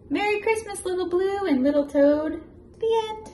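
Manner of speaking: lively, loud picture-book read-aloud; animated storybook voice for a little one; reading to a child. Acted. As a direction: read it big and bouncy for a toddler.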